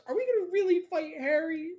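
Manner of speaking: high, quick character voice; high-pitched incredulous-sounding question; acted character's question. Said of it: "She goes".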